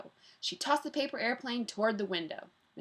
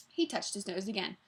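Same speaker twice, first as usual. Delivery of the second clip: plain reading voice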